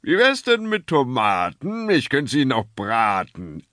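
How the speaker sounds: a cheery showman selling tomatoes; jolly snack-bar hawker. A narrator's reading. Snack-bar owner answers the father, jolly hawker. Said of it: "antwortet die Stimme".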